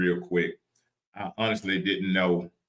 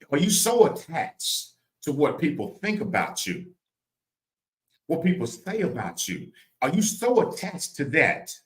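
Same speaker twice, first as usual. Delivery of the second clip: raised, emphatic preacher delivery; preaching; 6 semitones higher than usual. Real, not acted. Preacher challenging listeners on attachment, preaching. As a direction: press the question on the listeners.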